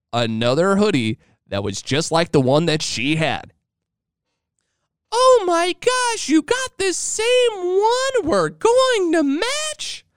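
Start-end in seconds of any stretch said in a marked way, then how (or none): 5.1-10.1 drawn-out falsetto squeal, very high; over-the-top excited wife. Retold or imitated. Wife spots the matching hoodie, mock over-the-top squeal. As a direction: squeal slowly, hamming up the wife's excitement. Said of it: "She was over the top excited".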